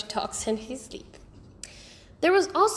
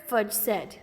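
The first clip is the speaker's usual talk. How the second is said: plain reading voice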